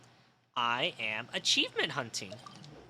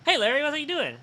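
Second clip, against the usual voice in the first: much higher, louder voice for the coworker; coworker calling out; 13 semitones higher, 8 dB louder, faster than usual. Retold or imitated. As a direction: play the Best Buy guy calling out to wake him. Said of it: "And he's like".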